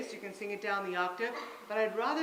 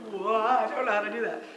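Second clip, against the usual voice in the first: confused student; 9 dB louder than usual. Retold or imitated. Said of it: "Everyone's like".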